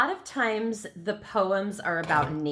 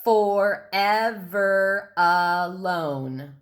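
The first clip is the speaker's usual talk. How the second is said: "Forever alone" in five slow beats, for-ev-er-a-lone; "Forever alone" at half speed, evenly chanted in separate syllables; counted-out syllables; 4 dB louder, much slower than usual. Shown as a demonstration. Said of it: "let's count out those syllables".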